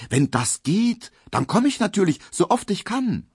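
put-on a young man thrilled at the invitation; eager Emil. A narrator's reading. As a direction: promise it happily. Emil voiced by narrator.

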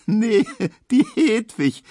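high, loud squeal through a laugh. A narrator's reading. Emma squeals with laughter at Hedwig's joke. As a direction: shriek with glee at the joke. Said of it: "quiekt Emma".

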